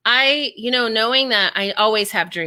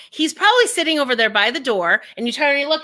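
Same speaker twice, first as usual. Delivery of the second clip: ordinary delivery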